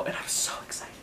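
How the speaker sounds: hushed, barely voiced thrill; excited whisper. Real, not acted. Fan confiding excitement about the TV show, excited whisper.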